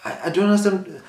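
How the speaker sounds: imitating a protest of not understanding; feigned confusion. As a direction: stammer it like someone playing dumb. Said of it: "acting like he doesn't understand".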